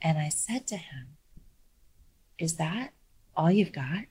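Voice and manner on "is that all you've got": very quiet and measured. Retold or imitated. Lawyer staring down the towering opposing counsel, very quiet and measured. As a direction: calmly dare him in a near-whisper. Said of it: "Very quietly, very measuredly".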